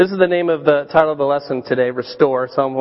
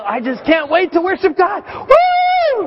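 excited shout; 12 semitones higher than usual. Acted. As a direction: shout it like an overjoyed worshipper. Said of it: "You know".

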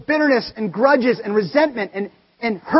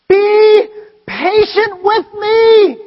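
high, loud, very slow pleading cry; begging servant; 10 semitones higher, 10 dB louder, much slower than usual. Acted. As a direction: plead desperately, dragging every word high and loud. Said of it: "he begged".

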